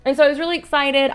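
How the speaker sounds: normal talking voice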